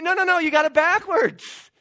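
high, loud, emphatic objection; loud protest. Acted. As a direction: cry out in protest, voice leaping up. Acted protest against Babylon's belief.

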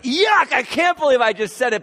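high, loud yuck of disgust; gagging disgust. Acted. Preacher voicing Paul gagging at his own boast, high and loud. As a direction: spit it out like it makes you gag. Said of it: "I kinda feel like he's, like, gagging".

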